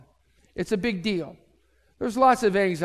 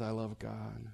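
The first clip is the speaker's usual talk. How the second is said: mock serene, much softer and lower; serene hush; 8 semitones lower, 13 dB quieter than usual. Date calmly answers mom, soft serene hush. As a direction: breathe it out peacefully.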